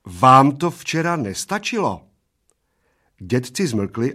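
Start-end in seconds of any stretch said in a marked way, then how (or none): 0.1-2.0 warning. A narrator's reading. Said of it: "varovala oba slovy".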